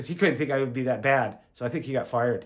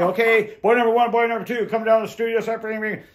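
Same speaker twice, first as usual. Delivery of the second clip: calling out; 7 semitones higher, 4 dB louder, faster than usual. Retold or imitated. Studio staff calling actors in.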